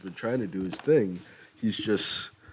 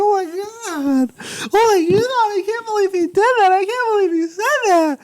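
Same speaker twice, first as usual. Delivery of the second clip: much higher, louder starstruck squeal; fanboy scream; 9 dB louder than usual. Retold or imitated. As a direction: shriek it way up like a giddy fan. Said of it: "I screamed".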